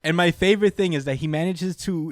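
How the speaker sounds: ordinary talk, nothing marked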